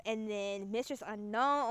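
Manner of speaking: ordinary talk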